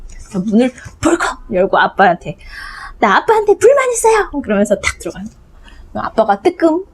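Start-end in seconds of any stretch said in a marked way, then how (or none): 3.0-4.3 put-on child's voice. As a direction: burst in on dad like a kid with a grievance. Child bursts in on dad.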